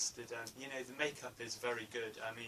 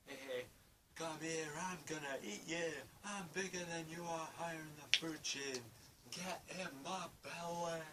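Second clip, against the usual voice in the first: higher hammed-up Scottish glutton voice; Fat Bastard impression; 6 semitones higher than usual. Fan quoting Fat Bastard threatening Mini-Me in a hammed-up Scottish voice. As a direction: leer it out in a higher hammed-up Scottish accent, slowing on the last line. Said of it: "and he's like".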